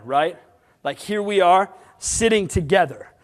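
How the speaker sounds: normal talking voice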